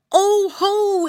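high, loud piglet exclaiming; excited exclaim. Acted. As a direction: burst out with discovery. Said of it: "exclaimed Curly".

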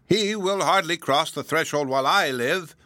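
put-on an old woman's fierce refusal; angry. A narrator's reading. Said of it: "answered her cousin angrily".

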